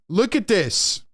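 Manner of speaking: high, amazed call to the chat; excited pointing out. Real, not acted. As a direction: insist they really look. Pointing at the coach's face.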